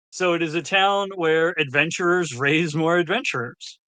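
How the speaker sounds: normal talking voice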